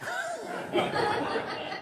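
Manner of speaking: breathy high giggle; a high, airy giggle of disbelief; an uncle's high, breathy laugh. Retold or imitated.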